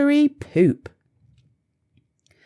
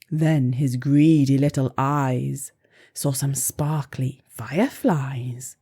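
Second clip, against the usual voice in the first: ordinary delivery, nothing marked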